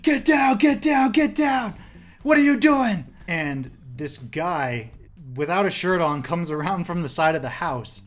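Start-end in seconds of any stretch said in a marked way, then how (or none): 0.0-3.0 much higher, louder barked command; retold police shout, much higher and louder; yelling cop. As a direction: yell it like a cop with a drawn gun. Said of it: "he's yelling".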